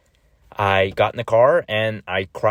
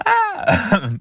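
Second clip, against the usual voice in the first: short high playful scream; loud joking "ah" of fright; mock scream; 6 dB louder than usual. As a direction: pretend shriek, much higher. Pretend scare at repenting.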